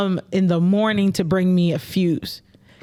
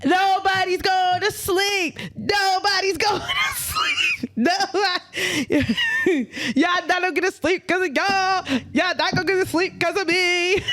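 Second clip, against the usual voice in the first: high, slow hammed-up yelling through laughs; mock rampage yell; 9 semitones higher, slower than usual. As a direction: rant at the whole hallway half laughing. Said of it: "I banged the pots together and said".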